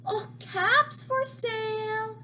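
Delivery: high, loud singsong street call. A narrator's reading. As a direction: hawk the caps, a bit desperate. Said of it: "He walked up and down the street calling".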